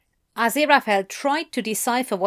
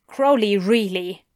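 usual speaking voice

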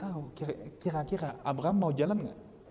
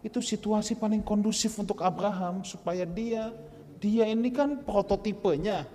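higher, building preaching voice; rising sermon; 8 semitones higher, 5 dB louder than usual. Acted. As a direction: drive the point home. (khotbah yang makin bersemangat)

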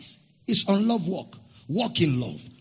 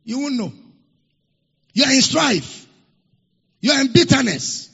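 rising, louder sermon cadence that hammers each line; preaching at full force; 6 semitones higher, 7 dB louder than usual. Acted. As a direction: preach it hard and drive the warning home. Pastor preaching to congregation.

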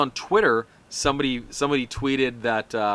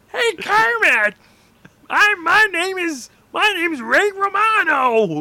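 high, slow strained shouting voice; Bobcat Goldthwait voice; 6 dB louder, slower than usual. Retold or imitated. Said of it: "You sound more like the guy".

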